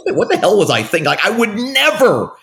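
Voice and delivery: high-pitched, laughing incredulity at himself; laughing disbelief. Retold or imitated. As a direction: retell it as if cracking up at your old self.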